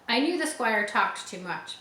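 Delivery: plain reading voice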